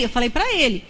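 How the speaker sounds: normal talking voice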